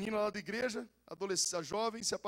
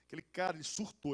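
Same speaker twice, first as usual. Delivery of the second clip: ordinary talk, nothing marked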